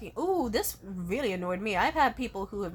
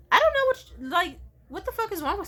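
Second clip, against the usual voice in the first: exasperated yelp; 10 semitones higher, 5 dB louder than usual. Real, not acted. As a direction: voice jumps high and loud. Exasperated outburst.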